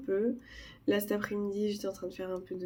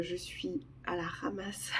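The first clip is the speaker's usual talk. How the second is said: whispering; 7 dB quieter, slower than usual. Real, not acted. Exhausted sick confession.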